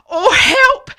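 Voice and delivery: high, loud mock cry of alarm; frightened snake. Snake seeing the Gruffalo, panicked cry. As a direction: yelp for help in hammed-up fright. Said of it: "Snake said".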